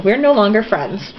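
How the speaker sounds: normal talking voice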